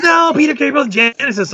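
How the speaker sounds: a high, insistent correction in his friend's voice; exasperated friend. Retold or imitated. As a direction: set him straight, near despair. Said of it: "He goes".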